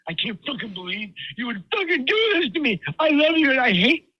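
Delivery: high, mock-tearful fake outrage; mock crying rant. Husband acting fake betrayal to wife, mock tearful. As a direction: fake wounded partner, half-hearted sobbing. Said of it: "got mad and started crying".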